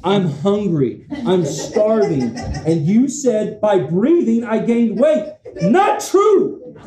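hammed-up protest that climbs to a squeal; mock outrage at the scale. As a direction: rant at the bathroom scale. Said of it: "I'm like".

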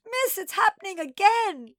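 high, loud panicked wail; shrieky, melodramatic high wail. A narrator's reading. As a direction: wail it like a distraught child. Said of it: "she wailed".

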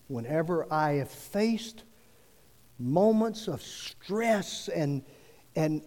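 ordinary talk, nothing marked

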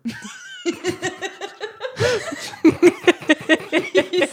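real delighted laughter; high burst of laughter. Real, not acted.

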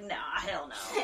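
low, soft comic refusal; imitating someone quietly bailing; dismissive refusal. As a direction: drop low and back out of it. Bernard backing out, acted out.

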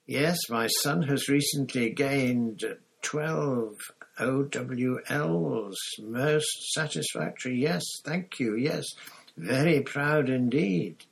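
a calm bureaucrat in a delusion; Crouch, pompous. A narrator's reading.

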